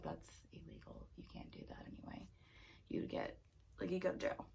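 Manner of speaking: hushed, half-whispered aside; inner thought. Acted. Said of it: "In my mind, it's like".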